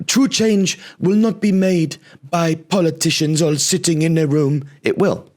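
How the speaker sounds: high, mock-earnest protester voice; parroting the protest line in a high whiny voice. Comic mocking climate protesters in a high whiny voice.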